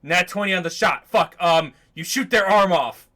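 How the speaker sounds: loud, exasperated game master ad-libbing; mock-exasperated dungeon master; frustrated DM. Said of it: "it's almost a thing that D Ms do".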